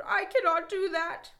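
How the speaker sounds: high, loud protest; shouted. A narrator's reading. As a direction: Toad shouting his refusal. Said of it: "shouted Toad".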